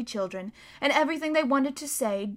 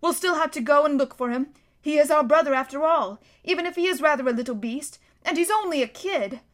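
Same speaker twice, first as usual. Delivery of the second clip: a hint of choked-up emotion, slightly higher; choking voice; 5 semitones higher, 4 dB louder than usual. A narrator's reading. Peter insisting on finding his brother, mildly choked. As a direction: hold back tears while insisting. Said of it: "in a rather choking sort of voice".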